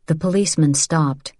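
ordinary delivery, nothing marked

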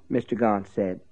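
usual speaking voice

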